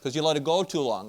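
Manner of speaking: mother shouting to be heard over the mower; mom yelling over mower. Retold or imitated. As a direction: call out over the engine noise. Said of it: "she's kinda yelling over the old Jacobson mower".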